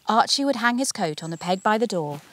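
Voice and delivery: ordinary delivery, nothing marked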